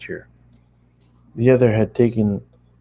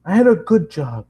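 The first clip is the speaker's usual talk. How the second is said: high, loud, bitter declaration; ranting at the girl about the lost job; angry rant; 8 semitones higher, 4 dB louder than usual. Acted. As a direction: push the loss hard.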